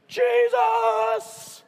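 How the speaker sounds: very high, loud drawn-out scream; hammed-up terrified holler; panicked shout. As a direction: yell desperately to wake a sleeper. Disciples shout to wake Jesus.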